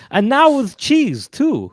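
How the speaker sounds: big, slow ad announcer. Acted. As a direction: sell it like a TV spot. Ad announcer parody.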